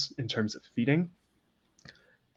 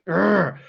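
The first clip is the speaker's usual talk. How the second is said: louder, higher growl of irritation; exasperated growl; 8 semitones higher, 7 dB louder than usual. Acted. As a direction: growl out your frustration at a friend. Irritated character cuts off her complaint.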